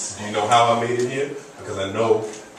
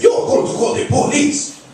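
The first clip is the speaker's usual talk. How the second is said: put-on loud, slow, high Haitian accent; mock outrage in a drawn-out Haitian accent; scandalized Haitian lady impression, shouted; crazy Haitian lady; 6 dB louder than usual. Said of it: "some crazy Haitian lady".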